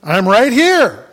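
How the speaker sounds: high, loud answering call; acted shout to those crying for help; calling back. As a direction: call out insistently to be found. Acting Jesus answering the crowd.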